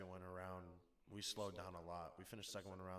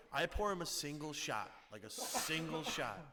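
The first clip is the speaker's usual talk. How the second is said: excited telling; 7 semitones higher, 7 dB louder than usual. Real, not acted. Excited retelling of the pour.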